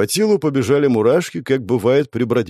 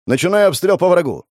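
higher, urgent announcement; raised soldier's battle cry; shouted report; 6 semitones higher, 4 dB louder than usual. A narrator's reading. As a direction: call out your attack to the squad. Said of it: "кричал телепортировавшийся лучник".

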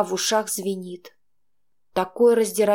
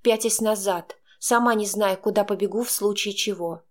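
ordinary delivery

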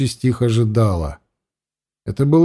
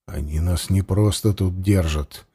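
low, quiet and insistent; hushed, with firm pressure; quiet and pressing; 7 semitones lower, 6 dB quieter than usual. A narrator's reading. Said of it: "тихо с нажимом заговорил Варлыга". (тихо, с нажимом)

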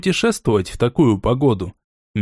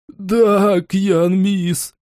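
sobbing; 5 semitones higher, much slower than usual. A narrator's reading. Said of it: "торопливо всхлипнул разбойник".

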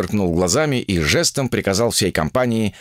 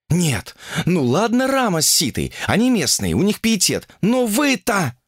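put-on high, exasperated young woman; indignant young woman; 11 semitones higher than usual. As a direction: argue with the crowd in a high, indignant voice. (возмущённая девушка, высоким голосом)

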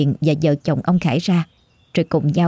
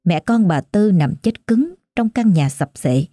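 plain reading voice

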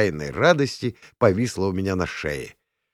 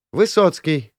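raised, eager shout of an answer; calling out; 8 semitones higher, 4 dB louder than usual. A narrator's reading. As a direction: cry out the answer in a higher voice. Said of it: "крикнула Ляля". (выкрикивая ответ)

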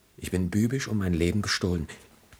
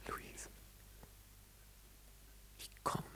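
faint whispered name; last whisper; dying whisper; 23 dB quieter than usual. Acted. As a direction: breathe her name as life leaves. Ferdinand dying, calls Luise.

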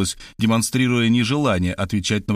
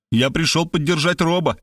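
higher, mildly thundering; thundering declaration; 6 semitones higher than usual. A narrator's reading. Zaslavsky answers his wife, mildly thundering. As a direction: declare it with emphatic force. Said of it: "прогремел он".

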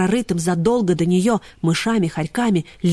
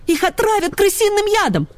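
alarmed; 13 semitones higher than usual. Acted. Ksyusha warns in alarm.

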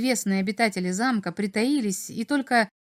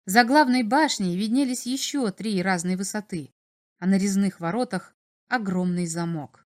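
ordinary delivery

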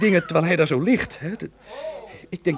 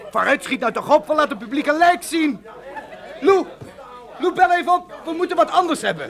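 bellowing orders; 9 semitones higher than usual. Acted. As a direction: bark commands at the crew in a panic. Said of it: "daarbovenuit brulde Ben, de regisseur".

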